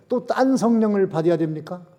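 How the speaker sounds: ordinary talk, nothing marked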